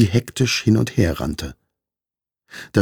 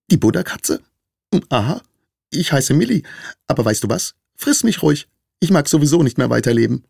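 high-pitched squeaky little mouse; piepsende Maus; 8 semitones higher, faster than usual. A narrator's reading. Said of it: "Die Maus schluckte und piepste schließlich". (hohe, piepsige Mäusestimme)

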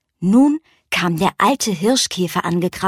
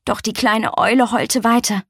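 plain reading voice

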